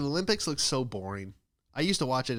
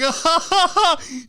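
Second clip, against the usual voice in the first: mock shrill laugh; 12 dB louder than usual. Mock laughing at the rich man.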